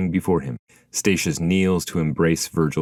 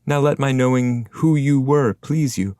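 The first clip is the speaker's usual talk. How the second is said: put-on higher, measured explanation from the old poet; voiced guide; 7 semitones higher, 3 dB louder than usual. A narrator's reading. Guide answers the spirit.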